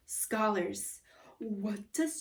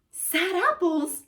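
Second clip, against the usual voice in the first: puzzled echo; 8 semitones higher, 6 dB louder, slower than usual. Acted. Kid puzzled repeating.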